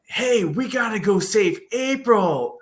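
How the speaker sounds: rally the team like an excited Turtle; high-pitched mock Turtle voice; Ninja Turtles. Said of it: "the Turtles are all like".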